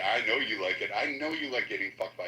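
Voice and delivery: low, put-on macho voice; deep manly voice. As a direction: drop the voice down to sound tough. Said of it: "Can you get some bass in that voice?".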